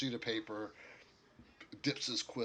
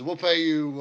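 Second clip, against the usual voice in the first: raised, loud orc voice; orc making an offer; 6 semitones higher, 13 dB louder than usual. Acted. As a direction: push the offer loudly. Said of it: "He says".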